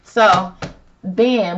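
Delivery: usual speaking voice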